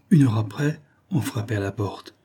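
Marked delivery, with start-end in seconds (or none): none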